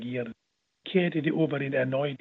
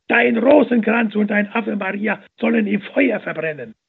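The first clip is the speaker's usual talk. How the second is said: high, loud angry roar; man kicking the nun and roaring at her; bellowing; 5 semitones higher, 7 dB louder than usual. A narrator's reading. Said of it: "Dabei brüllte er".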